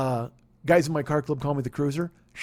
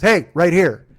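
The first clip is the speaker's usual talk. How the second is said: calling out; 9 dB louder, slower than usual. Retold or imitated. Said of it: "Jeremy Stevens goes".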